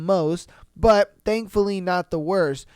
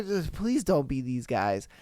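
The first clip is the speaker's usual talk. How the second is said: ordinary talk, nothing marked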